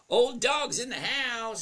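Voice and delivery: high, loud, drawn-out welcome; calling out. Real, not acted. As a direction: hail a newcomer loudly, stretching it out. Hailing a viewer joining the livestream.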